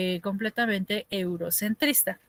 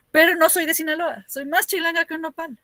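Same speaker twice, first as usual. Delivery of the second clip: Sinaloa accent; 6 semitones higher, 3 dB louder than usual. Shown as a demonstration. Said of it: "me sale el acento de Sinaloa".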